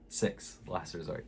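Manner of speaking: "Six, [name]" whispered under breath; whispered repeat. Shown as a demonstration.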